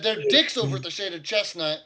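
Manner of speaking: innkeeper voice. Acted. Orc innkeeper grumbling about the rival guards, loud. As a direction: spit the complaint out. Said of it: "He said".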